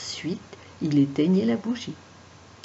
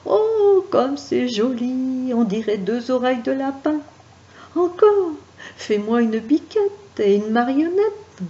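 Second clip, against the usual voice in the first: delighted; 7 semitones higher, 4 dB louder than usual. Acted. Delighted marquis watches shadows.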